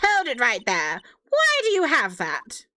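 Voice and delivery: courtroom challenge. Acted. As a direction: stretch out an indignant courtroom question.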